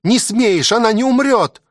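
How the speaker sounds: high, sustained cry of protest; desperate cry. A narrator's reading. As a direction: cry it out, refusing to accept it. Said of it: "крикнула она".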